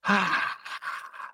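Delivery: breathy sigh, pitched high, letting all the air go; long exhale sigh. Real, not acted. Host leading listeners through breathing, audible release sigh. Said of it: "let it out with a sigh".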